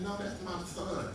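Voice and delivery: lightly voiced old woman, slow and high; mock little old lady. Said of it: "She said".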